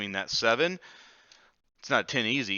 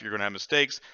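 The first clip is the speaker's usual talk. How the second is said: ordinary talk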